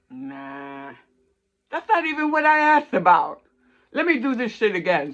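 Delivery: fed up with the cards, let it fly; tarot reader annoyed at her own deck, exasperated; frustrated outburst. Retold or imitated.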